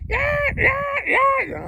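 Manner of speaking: very high, loud yelping cries; dog yelping. Retold or imitated. Dog rolling under the car, shrill loud yelps. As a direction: yelp like a hurt dog. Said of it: "you hear it".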